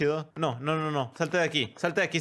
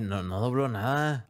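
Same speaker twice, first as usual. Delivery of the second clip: ordinary talk, nothing marked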